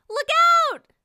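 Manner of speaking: sudden loud warning shout; scream. Acted. T P screaming a warning to Marshall. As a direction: yell out a warning. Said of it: "T P screamed".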